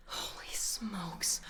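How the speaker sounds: slow breathless whisper; awed whisper to chat. Real, not acted. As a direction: hush it out in awe, slowly. Awed reaction to donation.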